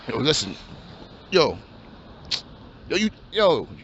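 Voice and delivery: low, laid-back street voice of a hopeful rapper; wannabe rapper. Acted. Aspiring rapper pitches his rap plan to his friend, low and laid-back.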